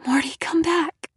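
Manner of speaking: weak whispered call. A narrator's reading. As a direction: whisper after the dog, hurt. Weak after crash.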